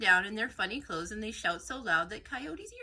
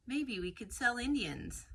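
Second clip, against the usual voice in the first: a lightly voiced Columbus, a bit higher, sly; Columbus scheming; 4 semitones higher than usual. A narrator's reading. Columbus pitching his plan to friends, lightly voiced and sly. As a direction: float the idea like a sly trader.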